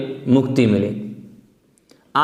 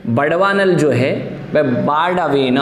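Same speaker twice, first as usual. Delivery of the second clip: ordinary talk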